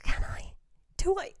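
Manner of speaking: hushed second thoughts; breathy self-questioning whisper; doubtful whisper. Real, not acted. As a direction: whisper to yourself. Doubting her own choice.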